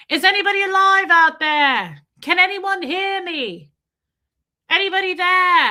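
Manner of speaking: high, loud mock call into the dark; desperate calling out. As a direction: call out like a lost survivor. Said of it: "He was like".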